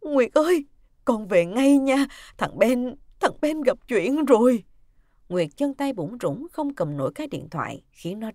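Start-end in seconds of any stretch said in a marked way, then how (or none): 0.0-4.6 loud, higher, distressed phone plea; urgent, frightened and trembling. Acted. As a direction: break bad news in a quavering voice. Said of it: "Giọng bà Liễu có vẻ run run".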